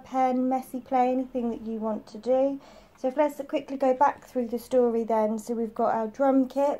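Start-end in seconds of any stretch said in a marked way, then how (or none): none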